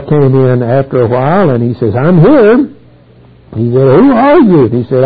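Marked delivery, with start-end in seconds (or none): 1.9-2.7 higher, louder voice, rising at the end; Esau announcing himself. Acted. Preacher acts Esau arriving before his father. 4.0-4.7 put-on high, loud, startled old father; startled question. Preacher acts startled Isaac questioning Esau.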